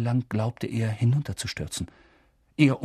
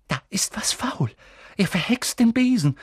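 urgent whisper; 8 semitones higher than usual. Acted. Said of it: "sagte Hermine".

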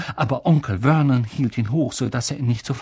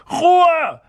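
high, loud outburst; screamed order; 6 dB louder than usual. A narrator's reading. Uncle silences Harry, screaming. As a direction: silence him with one furious yell. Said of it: "schrie Onkel Vernon".

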